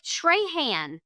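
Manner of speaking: "Trahan" as TRAY-han, slow and high; "Trahan" with a long A and hard final N; outsider's pronunciation. Retold or imitated. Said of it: "a teacher from somewhere totally different".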